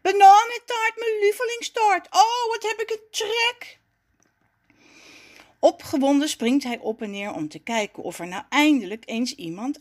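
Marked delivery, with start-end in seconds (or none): 0.0-3.8 high-pitched, gleeful exclaiming; delighted cry. A narrator's reading. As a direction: gush over a favourite treat, high and thrilled. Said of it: "roept Raffie verrukt". (dolblij en verrukt, met hoge stem)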